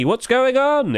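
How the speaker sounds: high amazed question; surprised. Real, not acted. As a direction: ask in disbelief. Surprised at low quantity.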